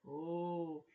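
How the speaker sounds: plain reading voice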